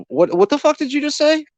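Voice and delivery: high-pitched, incredulous outburst, re-enacted; indignant disbelief. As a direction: throw it back at the officer, high and incredulous. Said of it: "That's exactly how I said it to him".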